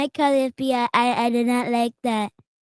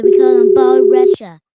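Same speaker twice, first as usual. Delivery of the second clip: calls out the nickname much louder; loud correction; 12 dB louder than usual. Real, not acted. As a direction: insists firmly on the right name.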